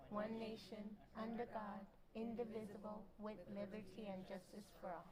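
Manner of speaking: steady, measured group recitation of the pledge; reciting the pledge. Acted. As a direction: recite it in a steady, even unison rhythm.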